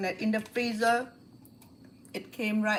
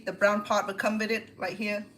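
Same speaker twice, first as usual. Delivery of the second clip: usual speaking voice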